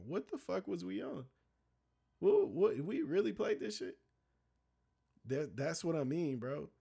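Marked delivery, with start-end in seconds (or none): 0.1-4.0 disbelief. Retold or imitated. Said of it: "and be like".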